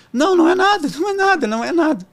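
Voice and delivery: high voiced mom insisting it's nothing; imitating mom. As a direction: play the mother brushing it off. Mother brushes off worry.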